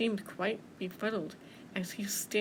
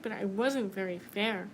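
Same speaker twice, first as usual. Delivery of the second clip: plain reading voice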